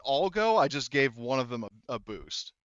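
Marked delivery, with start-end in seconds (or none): none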